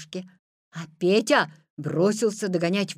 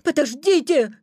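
loud, raised, urgent call; shouting; 6 semitones higher, 8 dB louder than usual. A narrator's reading. Petya calling to the running Wolf, urgent shout. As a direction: cry out to halt him. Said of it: "крикнул Петя".

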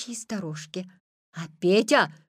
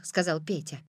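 ordinary delivery, nothing marked

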